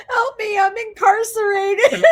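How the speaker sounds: high, loud, hammed-up cry for help; mock prisoner plea. Vlogger pretends to plead from jail, comic high wail. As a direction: squeal it, on the edge of laughter.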